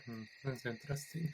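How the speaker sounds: very soft, private mutter; muttering to himself. Acted. Character inspects a found medallion, muttering to himself. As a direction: mutter it barely audibly, as if thinking aloud. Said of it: "he's just muttering to himself".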